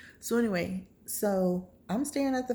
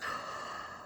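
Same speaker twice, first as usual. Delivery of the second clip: acted sharp gasp of shock; horrified gasp; 10 dB quieter than usual. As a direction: suck in air as if terrified. Acts out seeing his eyes.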